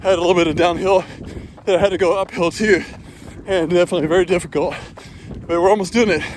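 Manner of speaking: breathless, talking mid-run; puffing between phrases on a run; while running. Real, not acted.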